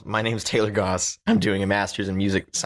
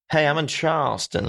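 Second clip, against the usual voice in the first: put-on a light Southern debutante accent, pitched up; mock Southern belle accent; 7 semitones higher than usual. Host joking about Southern posh, light mock Southern debutante accent. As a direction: toss it off like a Charleston debutante, half-joking. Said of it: "Southern debutante ball kinda accent".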